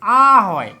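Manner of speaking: আ as a long, loud open "aah"; teacher sounding out আ as "aah"; letter-sound demo. Shown as a demonstration. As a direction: hold আ open and punch it out. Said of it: "উচ্চারণ যদি কি হয়?".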